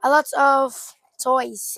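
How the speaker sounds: loud and slow, savouring each word; drawn out in loud wonder; excited. Real, not acted. Opening a box for her viewers, loud slow delight.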